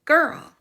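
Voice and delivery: put-on girl punched with English r and l; English pronunciation. Shown as a demonstration. Teacher shows learners the English word, girl clearly pronounced. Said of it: "en inglés".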